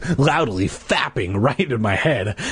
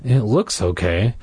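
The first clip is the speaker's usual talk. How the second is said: usual speaking voice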